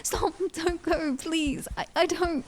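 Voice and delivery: high-pitched, desperate crying plea; tearful pleading. Acted. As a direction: beg through tears for them to stay. Desperate plea to departing brother.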